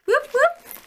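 high, loud whoop for the club; club cheer. Real, not acted. Vlogger shouts out her running club, excited cheer. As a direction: cheer them on like at the finish line.

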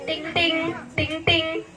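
ting ting like a ringing phone; phone ring imitation. Wife at home imitating phone ringing, sing-song ting ting. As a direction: mimic the phone bell.

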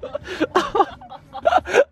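laughing through the pain; high burst of laughter. Real, not acted. Laughs after hurting leg.

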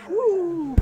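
high, loud cheer; excited whoop. Real, not acted. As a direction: let out a happy woo. Cheering after signing off.

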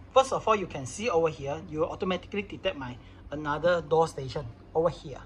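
ordinary talk

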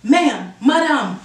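ordinary talk

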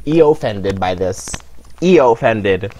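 low, slow show of offence; highly upset. Real, not acted. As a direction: draw it out low, deeply offended. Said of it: "I am highly upset".

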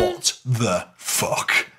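very slow, drawn-out disbelief; dumbfounded, word by word; stunned disbelief. Retold or imitated. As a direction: stretch every word in retold shock. Retold shock at the betrayal.

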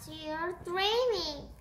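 high, slow rat voice; squeaky rat. A narrator's reading. Rat soothing the cat back to sleep, slow squeak. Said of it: "squeaked the rat".

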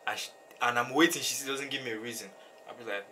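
ordinary talk, nothing marked

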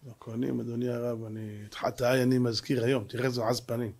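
low, very soft admission; sheepish confession. Acted. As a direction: confess quietly to the rabbi. Lawyer's shamefaced confession to rabbi. (וידוי שקט בקול נמוך)

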